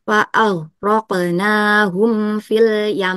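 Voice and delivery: slow recitation, the m of "yam" hummed long; long held ghunnah. Shown as a demonstration. Teacher demonstrating Quran recitation, slow with long hummed m. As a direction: draw out the final m of "yam" through the nose. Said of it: "ditahan dengan gunah sangat sempurna".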